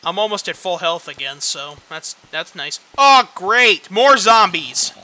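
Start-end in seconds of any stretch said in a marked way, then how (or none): 3.0-4.9 loud, slow sarcastic groan; sarcastic, fed up; sarcastic loud exasperation. Real, not acted. Sarcastic at zombie spawn.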